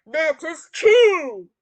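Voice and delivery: slow, loud, drawn-out giant voice; booming giant. Acted. Giant agreeing with the cat's flattery, slow loud boast. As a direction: boom it out, stretching the words.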